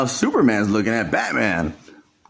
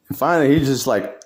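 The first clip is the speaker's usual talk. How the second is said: usual speaking voice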